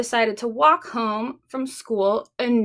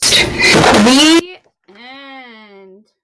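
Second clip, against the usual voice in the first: louder closing line; story ending; 3 semitones lower, 9 dB louder than usual. A narrator's reading. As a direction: close the book with a flourish. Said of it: "closing the story".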